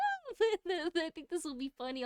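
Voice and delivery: high-pitched, giggly retelling; laughing while talking. Real, not acted. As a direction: talk through helpless laughter. Laughing at her own joke.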